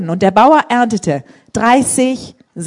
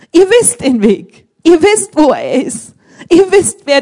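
emphatic; 7 semitones higher, 6 dB louder, slower than usual. Real, not acted. Preacher exhorting congregation.

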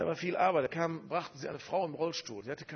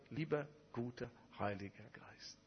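put-on quiet halting child-like prayer; 7 dB quieter than usual. The pastor praying for the first time, softly and falteringly. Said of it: "stotterte darum".

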